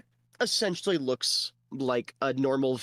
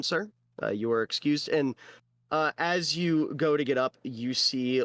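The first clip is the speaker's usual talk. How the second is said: ordinary talk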